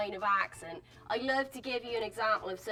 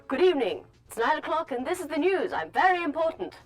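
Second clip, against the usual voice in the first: louder, higher BBC newsreader RP; 6 semitones higher, 7 dB louder than usual. Shown as a demonstration. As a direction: read it like a pompous BBC newscaster. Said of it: "what I'm speaking right now is R P".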